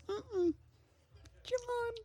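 very high, sing-song no; a prim, high-pitched refusal to the kid; teacher shutting it down. Retold or imitated. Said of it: "She goes".

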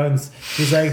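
ordinary delivery

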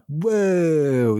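long, high whoa sliding downward; mock amazed whoa. Podcaster retelling his stunned reaction to the film, mock amazed. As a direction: draw out an awestruck whoa.

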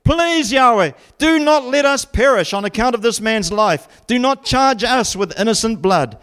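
high, pleading dramatic prayer; crying out in prayer. Acted. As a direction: beg God aloud, pitched well up. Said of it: "they cried out to Yahweh".

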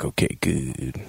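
low, soft, slow retold reply; subdued acceptance. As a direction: give in quietly, dropping low. Acts Trip's reply to reassurance.

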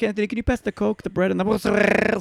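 high sister voice, mangled Italian mozzarella; over-the-top Italian. Acted. Third sister asking Anthony at dinner, over-the-top Italian. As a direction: outdo the last sister with an absurd Italian ending. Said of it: "the third sister's like".

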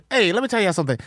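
ordinary talk, nothing marked

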